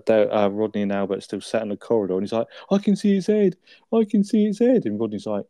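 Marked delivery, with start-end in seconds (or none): 2.7-4.8 high, loud, thrilled announcement; excited high yelp. Retold or imitated. Del running out excited.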